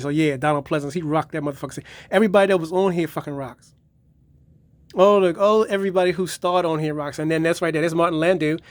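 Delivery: ordinary talk, nothing marked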